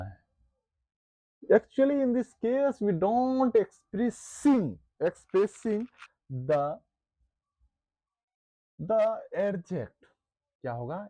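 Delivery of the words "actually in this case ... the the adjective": slow, word-by-word dictation pace. Shown as a demonstration. Teacher dictating a definition to the class, slow and deliberate. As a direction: dictate each word slowly so students can write. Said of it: "teacher dictating a definition slowly".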